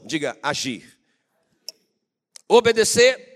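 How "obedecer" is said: loud, high call for the crowd to echo; preacher's rallying shout. Shown as a demonstration. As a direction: shout the key word out to the congregation. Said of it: "Grita aí".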